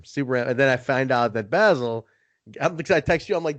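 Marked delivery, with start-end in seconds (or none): none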